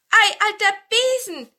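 hoher, lauter Ausruf; überrascht hoch ausrufen wie ein Zwerg; rufende Zwerge. A narrator's reading. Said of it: "riefen die Zwerge". (lauter Zwergenruf)

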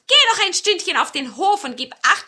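a crafty villain sweet-calling; marten calling out. A narrator's reading. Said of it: "rief da der böse Steinmarder".

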